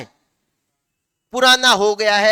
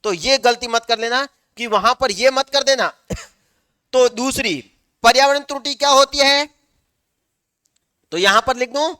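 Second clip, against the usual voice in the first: ordinary talk, nothing marked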